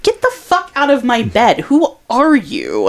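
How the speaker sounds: retold exasperation. Telling drunk stranger to leave her bed, exasperated. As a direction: replay the indignant order to get out.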